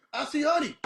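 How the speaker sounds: high whiny mock plea; whiny seer. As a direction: whine it like a clingy caller.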